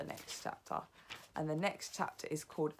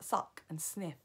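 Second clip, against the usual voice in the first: plain reading voice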